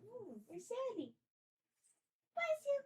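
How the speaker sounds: high cooing mock of her own baby talk; mimicking her cooing, very high on bless you; imitating herself cooing. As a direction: replay your own high pet voice.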